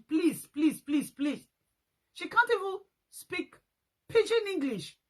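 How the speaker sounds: rising exasperated protest; please please, she can't even speak; exasperated scorn. Real, not acted. Scornful rant about the woman.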